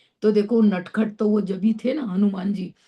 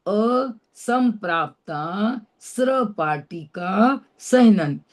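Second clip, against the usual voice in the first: असंप्राप्ता स्र पाटिका सहनन drawn out slowly, word by word; slow careful spelling-out; much slower than usual. Shown as a demonstration. Teacher repeating the full name for students, slow and clear. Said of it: "स के नीचे ऐसा गोल वाला र लगाते हैं".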